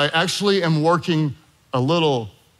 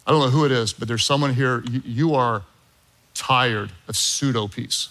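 usual speaking voice

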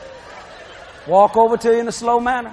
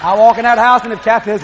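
usual speaking voice

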